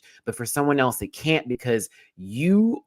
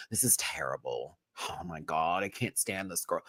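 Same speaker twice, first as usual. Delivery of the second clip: soft mock disgust; 6 semitones lower, 10 dB quieter than usual. Mock dramatic quote.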